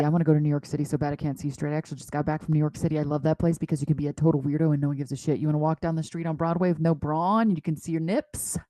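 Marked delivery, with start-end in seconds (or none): 0.0-6.7 fast, lower excited rush. Real, not acted. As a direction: rush through it.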